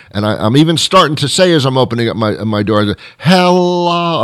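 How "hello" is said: high, stretched-out hello; eager mock hello, held high; singsong greeting. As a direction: call out a long, hammed-up greeting. Rehearses greeting while opening door.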